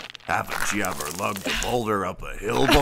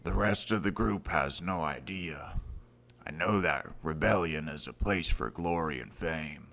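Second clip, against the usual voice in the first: somber confession; 2 semitones lower than usual. Acted. Mentor tells tragic tale.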